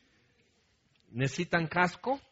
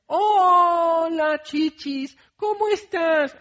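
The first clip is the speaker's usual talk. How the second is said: much higher, sweet gossip voice; sugary gossiping mom; 7 dB louder, much slower than usual. Retold or imitated. Mom greeting her friend on the phone, sugary high gossip voice. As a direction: switch instantly to a syrupy friend greeting. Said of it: "y empiezan así, con, así a comadrear".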